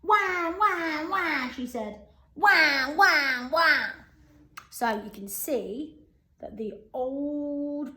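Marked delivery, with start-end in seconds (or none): 0.0-3.9 loud mock baby wah wah crying; hammed-up baby bawling. A narrator's reading. Grandma Josephine as a baby bawling her head off, hammed-up wahs. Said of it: "bawling her head off".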